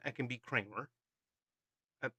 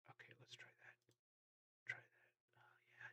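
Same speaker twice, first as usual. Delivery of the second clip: whisper; 27 dB quieter than usual. Shown as a demonstration. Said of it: "Do you like my whisper voice?".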